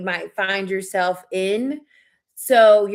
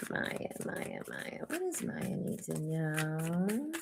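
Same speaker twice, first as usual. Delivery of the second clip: soft unvoiced whisper, slow; whispered incantation; 4 semitones lower, 16 dB quieter, slower than usual. Real, not acted. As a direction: murmur over the cards. Tarot pull incantation.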